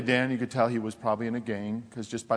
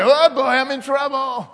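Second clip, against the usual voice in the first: loud, high and slowed, hammed-up alarm; mock panic; 14 semitones higher, 12 dB louder, slower than usual. Pastor retelling his thought on meeting his wife, mock alarm. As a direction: play up the dread of being in trouble.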